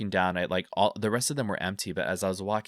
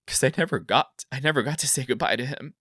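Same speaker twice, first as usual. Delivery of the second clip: higher, louder, choked-up grief; real emotional outpouring; 6 semitones higher, 4 dB louder than usual. Real, not acted. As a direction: let real grief push the voice up. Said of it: "getting super emotional".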